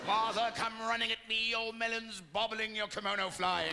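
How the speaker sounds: put-on grand theatrical British drunk; sustained, booming British actor voice; Richard Burton. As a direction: declaim it like a stage thespian. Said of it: "Now I'm like Richard Burton coming home".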